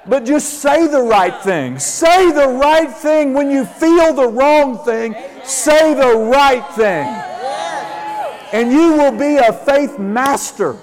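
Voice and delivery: loud, high preaching shout; full-force preaching. Real, not acted. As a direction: drive it home like a revival preacher. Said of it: "preaching at full force".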